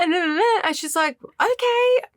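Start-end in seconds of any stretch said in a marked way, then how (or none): 1.4-2.1 much higher imitation of an unimpressed sister; put-on high, uninterested voice; high-pitched brush-off. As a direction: act out politely getting off the phone.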